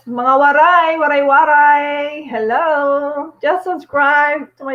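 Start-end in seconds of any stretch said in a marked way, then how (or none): none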